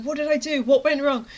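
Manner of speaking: high, puzzled urgency; mock-panicked bewilderment; frantic self-questioning. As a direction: ask it as if mentally panicking. Retold racing self-questioning.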